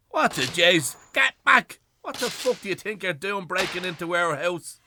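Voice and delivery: loud, high, outraged yell at the intruders; furious shout. Acted. Old man shouting at bailiffs breaking into his home, furious. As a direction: roar at them to get out.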